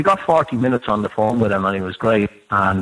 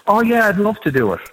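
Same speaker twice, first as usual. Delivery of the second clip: much higher, eager mock voice of the guest; 11 semitones higher than usual. Host retells the musician's keen reply, much higher mock voice. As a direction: pitch it way up, playing the eager guest. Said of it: "I get a message back from [name]".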